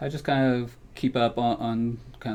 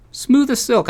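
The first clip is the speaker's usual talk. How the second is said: airy, much higher, falsely casual brag; breezy bluff; 12 dB louder, slower than usual. Acted. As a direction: pretend the terrifying flight was nothing. Lying to the cab driver.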